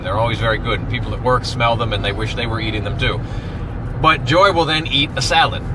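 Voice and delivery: ordinary delivery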